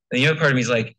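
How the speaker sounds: ordinary talk, nothing marked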